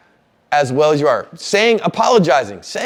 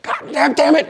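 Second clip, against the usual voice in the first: imitating angry curse; 12 semitones higher than usual.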